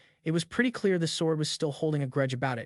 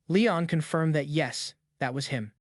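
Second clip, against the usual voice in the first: ordinary delivery, nothing marked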